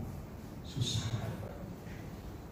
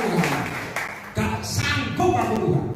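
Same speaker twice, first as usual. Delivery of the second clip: higher, slow mock whine; complaining to God; 5 semitones higher, slower than usual. Believer tells God he cannot cope, higher, slow mock whine. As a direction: act out a weary believer giving up. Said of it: "Mungkin kamu ngomong".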